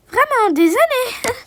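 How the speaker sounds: monter haut et ralentir, étonnée; s'étonner lentement, plus aigu; surprise étonnée. Acted. Surprise au téléphone. (femme étonnée apprenant la nouvelle par la police)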